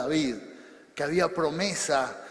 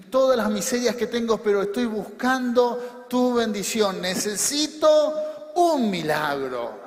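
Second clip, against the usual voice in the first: raised, insistent cry to God; fervent prayer plea; 2 semitones higher, 5 dB louder than usual. Acted. Preacher praying as a believer who needs a miracle, fervent plea. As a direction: pray it out loudly, pleading.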